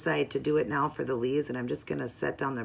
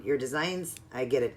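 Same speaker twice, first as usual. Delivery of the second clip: ordinary talk, nothing marked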